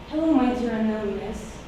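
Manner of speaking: ordinary delivery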